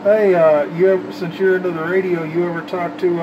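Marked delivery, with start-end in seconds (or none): none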